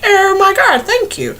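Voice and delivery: high, gushing mock gratitude; mock flattered reply. Vlogger mocking her own flattered reply to people. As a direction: act overly touched by the praise. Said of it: "I'm like".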